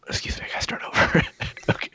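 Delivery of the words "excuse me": ordinary talk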